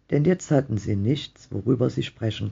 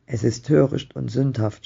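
ordinary delivery

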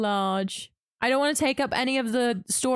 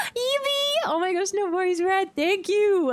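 excited greeting; 8 semitones higher than usual. Real, not acted. Streamer thanks a viewer mid-sentence, excited high greeting. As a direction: squeal a delighted welcome.

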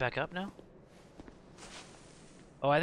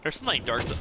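normal talking voice

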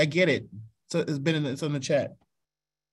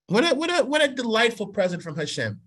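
starts much higher, full of wonder; delighted exclamation; 8 semitones higher than usual. Real, not acted. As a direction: marvel at the gift. Exclaiming delight at God's gift.

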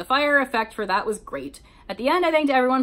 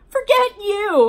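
high, loud yell after a rival; 11 semitones higher, 6 dB louder, slower than usual. Acted. Narrator's heroine yells after her rival, loud and high. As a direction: fling it after her at full volume. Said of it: "I yelled after her".

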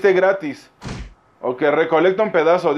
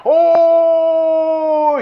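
long, high, loud "ooh"; hammed-up sustained gasp of wonder; amazed cry; 8 semitones higher, 5 dB louder than usual. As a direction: hold one high note of amazement. Amazed reaction to the game.

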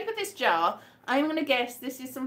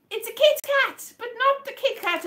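excited; 12 semitones higher, 4 dB louder than usual. Real, not acted. Guessing items by touch.

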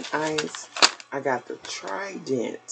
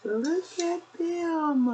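delighted; much slower than usual. Real, not acted. Showing off new plates.

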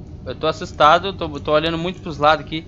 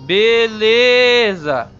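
stretched out; 7 semitones higher, 8 dB louder, much slower than usual. Real, not acted. Drawn-out reaction to game.

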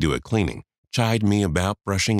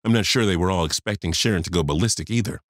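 plain reading voice

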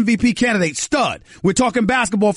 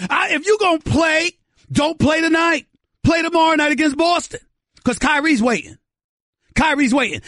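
fired-up rant; 8 semitones higher than usual. Real, not acted. Heated radio rant.

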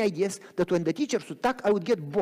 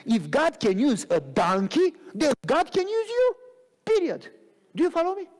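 higher, building sermon cadence; rising preaching; 8 semitones higher than usual. Acted. Preacher rallies the church that God uses anybody, rising. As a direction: lift the congregation with each phrase.

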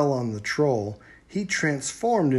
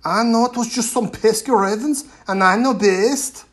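louder, dismissive chief shout; 10 semitones higher, 9 dB louder than usual. A narrator's reading. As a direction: cut her off loudly. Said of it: "the chieftain shouted".

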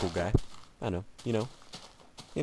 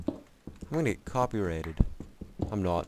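ordinary talk, nothing marked

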